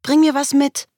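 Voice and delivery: put-on high, lively little-kid call; child's voice. A narrator's reading. Child calling to departing parent, high and eager.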